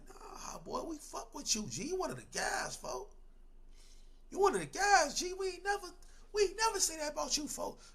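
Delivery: high, soft, nervous pleading; scared denial. Retold or imitated. Rivals caught out denying to the narrator, high soft plea. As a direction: plead your innocence quietly, way up high. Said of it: "Folks looked at me so lost".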